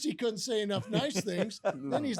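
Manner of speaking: normal talking voice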